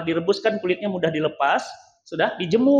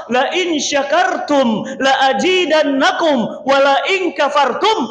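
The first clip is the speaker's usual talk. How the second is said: loud, higher, slow Quranic recitation; fervent recitation; 6 semitones higher, 5 dB louder, slower than usual. Acted. Preacher proclaims the verse to the crowd, loud and slow.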